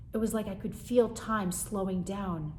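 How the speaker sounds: ordinary delivery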